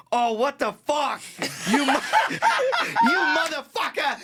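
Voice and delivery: put-on high, shouted Boston accent; hammed-up Boston yell of outrage; mock Boston accent. Run-over guy cursing the driver, mock Boston accent. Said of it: "what is his accent?".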